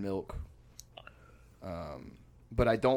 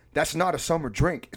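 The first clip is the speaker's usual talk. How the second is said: higher, louder mock outrage; imitated aggressive objection; 6 semitones higher, 6 dB louder than usual. Roommate objects to friend's glass of milk, retold aggressively. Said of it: "He's getting really aggressive with me".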